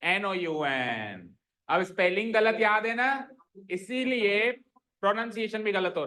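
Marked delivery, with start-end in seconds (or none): 0.0-1.4 slow spelling. Shown as a demonstration. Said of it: "spelling बताओ".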